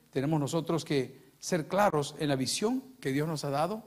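ordinary talk

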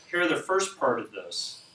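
measured prayer with long pauses; unhurried, reverent petition; spoken prayer. Real, not acted. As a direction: address God earnestly, pausing between phrases. Leads a prayer.

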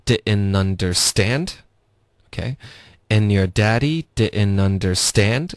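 slow linked line, stress on DA of "daddy" and "stand"; two stresses. Shown as a demonstration. Teacher modelling the sentence, stress on "daddy" and "understand". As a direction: punch "DAddy" and "underSTAND", reduce the rest. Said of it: "所以我们也有两个重音，daddy的da".

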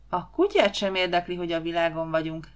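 ordinary delivery

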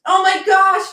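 high, loud burst of delight; excited exclaim. Real, not acted. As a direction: gasp it out, thrilled. Thrilled by a tip on stream.

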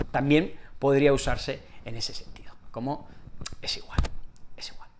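soft unvoiced whisper; whispered aside. Shown as a demonstration. Whispering an extra usage to learners. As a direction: drop to a hushed whisper. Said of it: "whispered aside".

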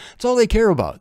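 ordinary talk

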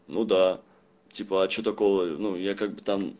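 ordinary talk

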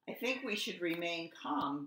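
ordinary delivery, nothing marked

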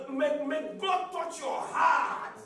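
loud, slow, fervent blessing; fervent prayer. Real, not acted. Preacher shouting a blessing over the church. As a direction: pray it over them with force.

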